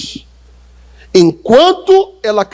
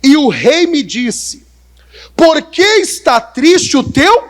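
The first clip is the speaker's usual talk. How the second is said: reading the king's words; 8 semitones higher, 3 dB louder, slower than usual. Acted. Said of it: "Neemias capítulo dois, verso dois".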